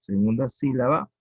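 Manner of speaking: ordinary talk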